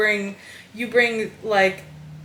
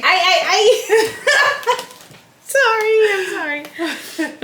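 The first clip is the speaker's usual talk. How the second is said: laughing; 10 semitones higher, much slower than usual. Real, not acted. Giggling fit.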